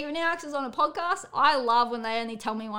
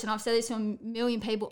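ordinary delivery

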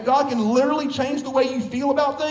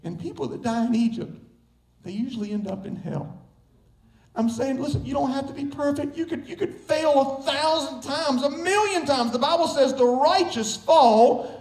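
normal talking voice

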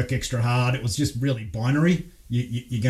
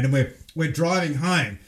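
ordinary delivery

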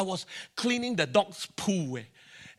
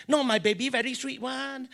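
higher, cooing insistence; doting dog owner; 5 semitones higher than usual. Retold or imitated. Dog owner insisting the dog is a baby, doting coo.

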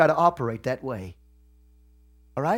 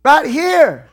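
high, loud shouted preaching; full-force preaching; 10 semitones higher, 9 dB louder, slower than usual. Real, not acted. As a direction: hit them hard from the pulpit.

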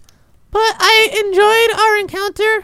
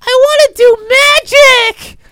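pleading shout; 4 semitones higher, 7 dB louder, slower than usual. Retold or imitated. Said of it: "And I was like".

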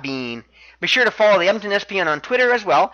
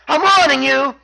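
high, raised mock threat; imitated angry warning; 7 semitones higher, 4 dB louder than usual. Champion at his wits' end with challenger, high imitated warning. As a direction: warn him sharply, pitched up. Neville warns over the cries.

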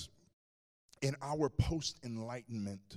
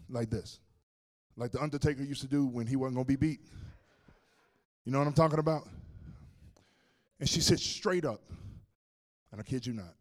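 ordinary delivery

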